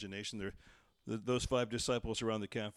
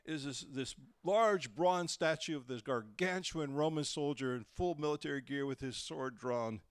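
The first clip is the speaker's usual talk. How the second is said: ordinary talk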